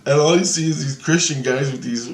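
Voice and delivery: plain reading voice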